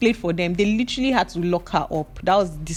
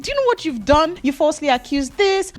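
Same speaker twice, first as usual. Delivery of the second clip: put-on scolding boyfriend; 7 semitones higher than usual. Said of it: "The boy said".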